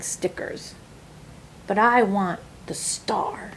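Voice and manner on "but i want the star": plain reading voice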